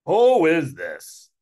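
loud, drawn-out Brooklyn demand; Uncle Murray. Acted. Uncle challenging the mystery caller, loud Brooklyn accent. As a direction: stretch it out loudly in a Brooklyn accent. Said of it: "Me, I give him a Brooklyn accent".

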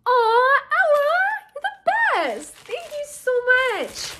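very high, cooing thanks; high-pitched "aww" gush, slowing and softening; touched gushing. Real, not acted. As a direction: coo gratefully, melting with affection. Touched by the fan's note.

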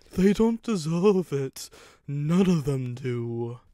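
slow, laboured, pained plea; wheezing engine. A narrator's reading. Wrecked engine pleading for others, slow and laboured. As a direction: draw the words out with effort.

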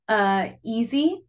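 drawn-out, breezy easy; an easy, breezy demo word; slow easy. Shown as a demonstration. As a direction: stretch out easy slowly as if it's nothing. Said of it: "demonstrating sign word easy".

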